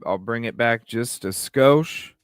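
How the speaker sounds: ordinary delivery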